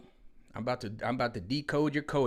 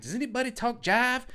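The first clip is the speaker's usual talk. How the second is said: much higher prim mock voice; mock flight attendant; 13 semitones higher, 5 dB louder than usual. Vlogger mimics the Airplane movie scene, high prim voice. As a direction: ask it in a high, proper little voice. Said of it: "they were like".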